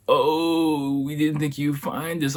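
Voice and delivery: high-pitched imitation of astonished friends; hammed-up amazed reaction; mock surprise.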